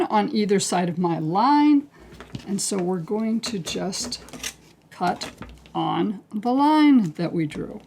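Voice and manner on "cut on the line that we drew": ordinary talk, nothing marked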